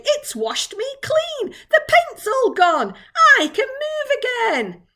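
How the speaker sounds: high, loud, jubilant cries; excited shout. A narrator's reading. Centipede finds it can move again, shouting excitedly. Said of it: "shouted the centipede excitedly".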